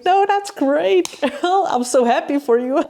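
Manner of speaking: very high, gushing mock delight with a laugh; over-the-top fake happiness, amused; laughing congratulations. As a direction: squeal it sweetly, cracking up. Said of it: "And I started laughing".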